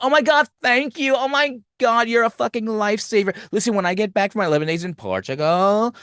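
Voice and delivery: high, excited gushing friend voice; gushing valley girl. Retold or imitated. Successful friend bragging about her Portugal trip, gushing high. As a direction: gush like a bubbly valley girl. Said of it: "She's like".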